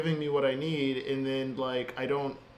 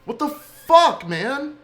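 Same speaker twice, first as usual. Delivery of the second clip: real exasperated shout, higher and much louder; frustrated yell; 10 semitones higher, 9 dB louder, slower than usual. Real, not acted. Stuck developer yelling at his code, fed up and loud. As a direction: explode at the screen, drawn out.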